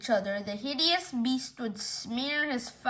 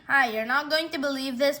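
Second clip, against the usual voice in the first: plain reading voice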